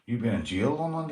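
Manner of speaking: ordinary talk, nothing marked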